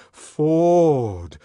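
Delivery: rapturous long-held call; ecstatic voice. A narrator's reading. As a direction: stretch it out, then let it fall. Chant of ecstatic ceremony voice.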